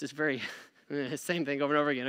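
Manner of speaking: quick, soft words through a laugh. Real, not acted. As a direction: laugh at yourself while rushing it. Admits practicing the reading.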